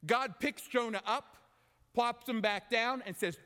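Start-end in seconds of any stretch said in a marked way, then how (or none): none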